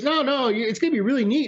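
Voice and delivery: high, eager mock insistence; imitating eager friend. Imitating Jerry insisting on the gift, eager high-pitched pleading. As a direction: push it like an excited pal who won't quit.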